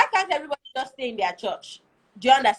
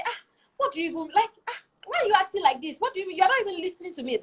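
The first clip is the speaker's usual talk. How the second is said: higher, quicker fed-up complaining; exasperated retort; 6 semitones higher, faster than usual. Retold or imitated. Wife snapping back at husband, fast and higher.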